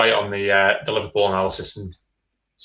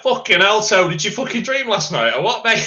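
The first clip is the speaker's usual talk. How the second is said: exasperated outburst; 11 semitones higher, 4 dB louder than usual. Retold or imitated. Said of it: "I was like".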